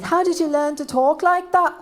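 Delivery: hammed-up British-accented question. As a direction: ask it as a mock British student. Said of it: "speak with a British accent".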